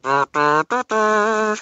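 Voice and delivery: dim-witted mock dur-dur; dopey dur voice. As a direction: play it dumb, mockingly. Mocking dumb expanding brain.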